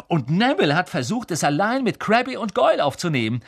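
high, eager rapid chatter; excited. A narrator's reading. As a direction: babble on full of excitement. Ron babbling excitedly after the win.

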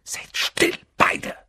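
whispered hiss; urgent whisper. A narrator's reading. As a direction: hush them sharply. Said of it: "zischte Harry".

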